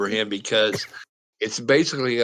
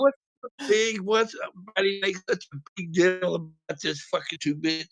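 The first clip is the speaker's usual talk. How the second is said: high-pitched mock complaint; retold indignation, higher voice; imitated indignant protest; 6 semitones higher, 4 dB quieter than usual. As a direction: act out a friend griping about the fuss. Acting the friend's loud protest.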